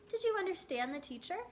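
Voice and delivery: quick, lightly voiced mother. A narrator's reading. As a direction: ask briskly like a mom.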